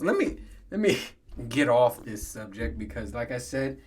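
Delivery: ordinary talk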